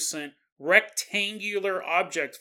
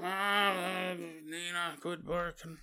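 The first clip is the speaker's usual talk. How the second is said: sleepy grumble; 8 dB quieter, slower than usual. Retold or imitated. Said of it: "and Ray's like".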